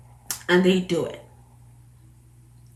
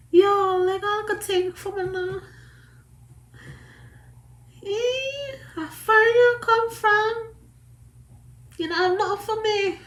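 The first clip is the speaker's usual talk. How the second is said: Jamaican catcaller; 12 semitones higher than usual. Retold or imitated. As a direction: hit on her like a yard man. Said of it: "you know what I ask?".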